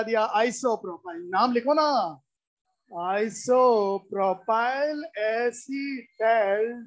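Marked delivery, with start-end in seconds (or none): 2.9-6.8 very slow, syllable-by-syllable naming; slow dictation. Real, not acted. Teacher writes out the aldehyde's name for students, very slow dictation. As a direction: spell it out by ear, each syllable held long.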